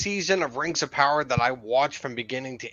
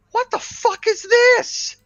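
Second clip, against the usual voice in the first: high, loud, slowed disbelief; like you can't believe what's on screen; outraged disbelief; 6 dB louder, slower than usual. Retold or imitated. Retelling his reaction to the scene.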